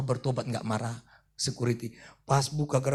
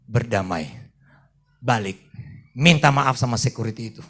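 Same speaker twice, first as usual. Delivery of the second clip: voice of the Holy Spirit; 2 semitones lower, 7 dB louder than usual. Retold or imitated. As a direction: speak as God gently ordering him back. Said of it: "Roh Kudus bicara".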